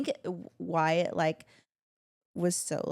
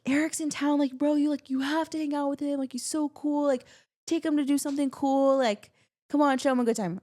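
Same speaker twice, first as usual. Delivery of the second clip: higher, hyped-up bro voice; mock brother pleading, pitched up; imitating Zach; 7 semitones higher than usual. Brother on FaceTime urging her to hang out, hyped. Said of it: "and he's like".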